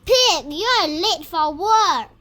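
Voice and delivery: loud call to the late pig; teacher calls out for a pig who is late to work. Acted. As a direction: shout for a late pig, playfully scolding. Calling pig to class.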